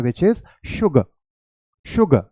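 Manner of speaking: sugar said with sh, not s; correct sugar. Shown as a demonstration. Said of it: "टंग को नीचे लाना पड़ेगा".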